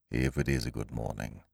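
low, soft, dragging donkey voice; gloomy Eeyore. A narrator's reading. Eeyore doubts Pooh's good morning, low and mournful. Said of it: "said Eeyore gloomily".

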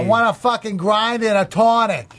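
put-on broad improvised Boston guy accent. Improvising a guy from Boston for the others, broad Boston accent. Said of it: "a guy from Boston".

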